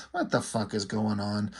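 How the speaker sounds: low, muted grumble; bewildered aside. Acted. Magneto watches the battle from afar, quiet bewildered aside.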